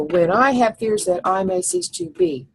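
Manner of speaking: each syllable tapped out evenly; counting syllables. Shown as a demonstration. Teacher counting syllables of the line. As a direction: count the line out on your fingers. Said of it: "I just use my fingers, and I just go".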